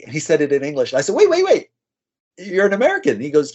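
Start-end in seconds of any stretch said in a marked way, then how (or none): 1.1-3.1 sudden, much higher retold surprise; surprised. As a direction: jump up in pitch as if catching a surprise. Meeting a fellow American abroad.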